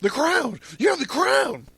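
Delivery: very high-pitched gushing exclamation; over-the-top delighted Salome voice; ecstatic. A narrator's reading. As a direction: squeal it in hammed-up rapture. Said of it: "Salome, who's ecstatic".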